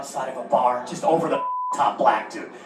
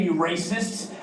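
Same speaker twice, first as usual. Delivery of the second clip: normal talking voice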